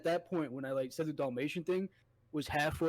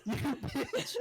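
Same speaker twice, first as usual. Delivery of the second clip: laughing jab; 9 semitones higher than usual. Retold or imitated. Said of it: "He's like".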